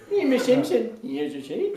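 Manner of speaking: cartoon impression with two notes at once; Homer Simpson. Shown as a demonstration. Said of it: "Homer Simpson".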